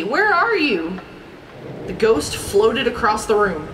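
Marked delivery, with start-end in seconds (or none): 0.0-1.0 high questioning call; mildly anxious storybook call. A narrator's reading. Daisy looks for Gladstone in the dark, calling out. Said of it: "called Daisy".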